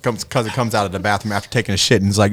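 usual speaking voice

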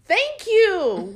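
backing up her friend's point loudly; emphatic thanks. Real, not acted. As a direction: hammer home the agreement. Emphatic agreement.

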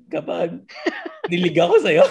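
laughing through the words. Real, not acted. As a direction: giggle it out, embarrassed. Laughing while admitting kilig.

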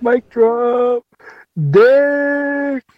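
excited. Real, not acted. Reacting to the rap.

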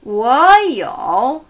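careful Mandarin tones on Wo you; second and third tone. Shown as a demonstration. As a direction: model Wo you with a climb, then a dip. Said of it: "zweiter und dritter Ton".

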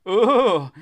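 high, loud disgusted moan. A narrator's reading. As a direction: moan out in revulsion. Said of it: "I let out a moan".

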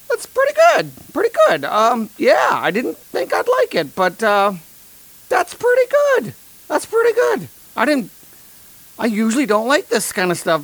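squeaky, very high forced praise; lying about the beer in a strained, very high voice; voice jumping up an octave. Retold or imitated. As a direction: fake enthusiasm that shoots way up high. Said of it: "my voice goes up an octave".

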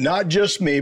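ordinary talk, nothing marked

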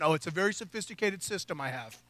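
low, soft mock-proud teenager; smug teen excuse. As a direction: brush it off, quietly smug.

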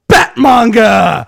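big, slow, drawn-out shout; loud, stretched-out hype shout; grand reveal. Acted. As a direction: announce it like a superhero title. Dramatic reveal of the title.

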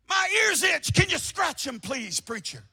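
high pleading whine; mock itchy-eared listener. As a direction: beg the preacher like a spoiled hearer. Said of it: "the world is saying".

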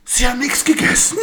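high, drawn-out mock shout; exaggerated outraged disbelief, very loud; scolding nurse. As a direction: shriek it as an appalled nurse, hammed up. Nurse scolds patient in retold scene.

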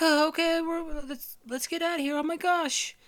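put-on very high, flustered kid voice; Andy panicking. Andy fleeing the fire, high flustered kid. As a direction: scramble like a scared boy. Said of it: "He's like".

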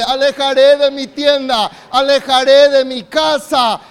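slow, loud shouted proclamation; loud shouted declaration. Real, not acted. Preacher shouting a declaration for the church to repeat. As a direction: shout it out slowly in Jesus' name. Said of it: "Diga bien fuerte".

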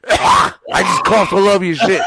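laughing hard through the line. Real, not acted. Telling friends about the cough, laughing loudly. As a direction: cracks up, loud.

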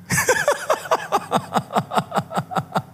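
high, airy laugh; long string of breathy chuckles; real chuckling fit. Real, not acted. Laughs after admitting the difficulty.

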